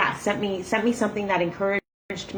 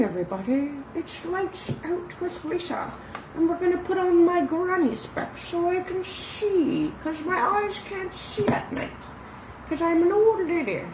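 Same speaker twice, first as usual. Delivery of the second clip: put-on nighttime old lady voice; 10 semitones higher than usual. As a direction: play a sleepy old granny reading at night. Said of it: "let's do the nighttime voice".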